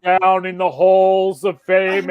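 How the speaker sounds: loud, high, slow pomp; mock-grandiose. Co-host parodying the host's praise, mock-grandiose. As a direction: proclaim it like overblown praise.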